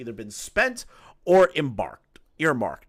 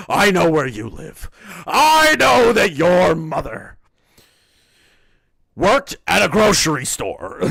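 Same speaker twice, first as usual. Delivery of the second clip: menacing villain; 6 semitones higher, 10 dB louder than usual. Acted. Reading a threatening tweet aloud.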